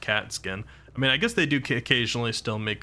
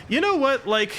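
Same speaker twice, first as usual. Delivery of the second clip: excited; 13 semitones higher, 4 dB louder than usual. Real, not acted. Sudden excited outburst.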